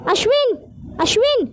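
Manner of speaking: calling Ashwin loudly from another room. Acted. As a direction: call out high and loud across the house. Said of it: "मोठ्या मोठ्याने अश्विनला हाका मारतो".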